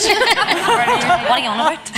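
real laughter; laughing. Real, not acted. Laughing denial.

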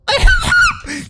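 high shrieking scream of fright; startled scream. Real, not acted. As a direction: shriek in real terror. Shopkeeper scares him.

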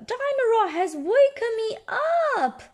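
put-on high-pitched child's complaint; high, indignant little-girl voice; complaining little Peppa. A narrator's reading. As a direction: whine it high as a woken child.

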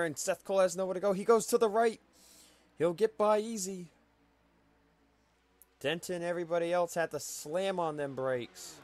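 high, fast, yelling play-by-play; excited shout. Real, not acted. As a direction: call the near-crash at full pitch. Wreck avoided, commentator erupts.

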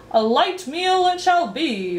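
high, drawn-out proclamation; roaring. A narrator's reading. Humbug announcing the meal Milo asked for, roaring. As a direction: roar it grandly like a pompous announcer. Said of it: "roared the bug".

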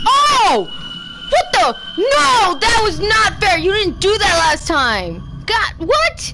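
loud, high protest at unfairness; outraged shouting. Real, not acted. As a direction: yell at the game in outrage. Unfair death in game.

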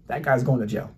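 usual speaking voice

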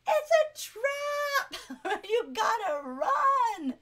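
high, loud, drawn-out warning cry; hammed-up yell to warn doomed sailors; mock alarmed shout.

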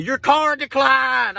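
high, drawn-out mock shout; loud callout. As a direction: call it out high and slow, imitating a public shout. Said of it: "will you lower your voice".

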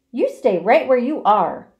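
loud, firm scolding; stern scolding. Acted. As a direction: put your foot down. Mother scolding Fern.

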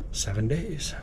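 ordinary talk

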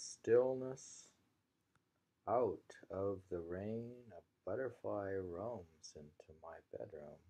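slow, deliberate haiku reading with the first word ringing out; poem reading. Acted. Teacher reading a haiku aloud to the class, slow and deliberate. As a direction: let each image hang before the next.

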